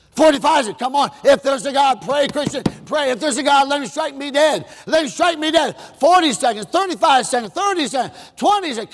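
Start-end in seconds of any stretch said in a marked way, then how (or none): 0.8-5.8 taunting atheist. Retold or imitated. Preacher voicing Ingersoll daring God, taunting.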